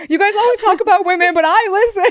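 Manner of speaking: loud, high, rapid-fire mock fan; imagined eager fan. Imagined listener calling to the hosts, hammed-up eager shout. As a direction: blurt it out high and fast, hammed up. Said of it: "maybe he was a pod best and he goes".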